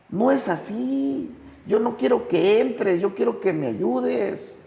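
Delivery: high-pitched, drawn-out imitated plea; acted desperate protest. Trapped alcoholic to the one who fell in, high insistent protest. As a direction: plead from the pit, pitch pushed way up.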